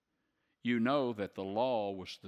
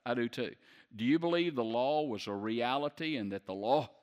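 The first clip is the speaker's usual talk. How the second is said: normal talking voice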